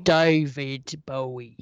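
low crooner take. Shown as a demonstration. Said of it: "It should be more like".

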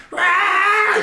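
high shouted war-elephant roar. Retold or imitated. Storyteller imitating a charging war elephant's roar.

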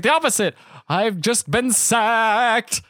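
high, drawn-out joyful announcement; hammed-up glee. Banker telling worried family of his firing, gleefully. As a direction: stretch the news out triumphantly.

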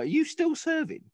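very high incredulous squeak; aching body asking if he's still a soldier, high squeak; mock body voice. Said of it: "body's like".

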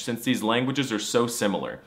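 ordinary talk, nothing marked

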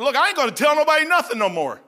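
retelling his angry complaint to God; fed-up outburst. Retold or imitated. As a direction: throw it at God in frustration. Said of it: "I said".

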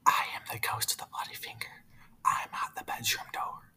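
soft eerie whisper at the door; a ghost whispering outside the door; spooky whispered ghost voice; ghostly whisper. Acted. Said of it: "the ghost coming even closer".

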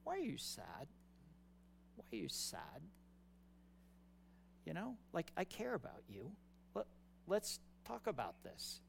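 hushed, caring question to someone hurting; tender God. Acted. God to a sad person amid the world's chaos, tender and hushed. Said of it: "God looks at you and he goes".

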